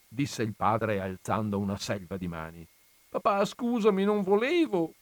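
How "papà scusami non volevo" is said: put-on high, whining little boy; whimpering child. A narrator's reading. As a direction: whimper an apology to dad. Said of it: "piagnucolò Bach Gaien".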